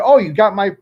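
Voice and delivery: toned-down snide comeback; not so nice retort. Retold or imitated. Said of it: "I didn't say it that, that nicely".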